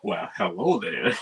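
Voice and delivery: swooping, put-on flirty greeting; Marv. Marv greets the woman nearby, swooping mock-flirty voice. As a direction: slide the pitch up and down on a mock come-on. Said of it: "Marv was like".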